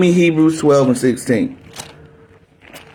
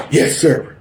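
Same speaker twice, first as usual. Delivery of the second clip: mock recruit reply. Recruit answering drill sergeant.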